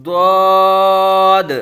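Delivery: ضاد as a thick, heavy d-sound, loud and very long; full-mouthed Arabic Daad. Shown as a demonstration. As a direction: fill the mouth on ضاد and hold it level. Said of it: "اس کو پر پڑھیں گے".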